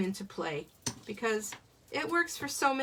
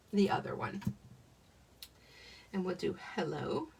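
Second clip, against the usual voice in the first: usual speaking voice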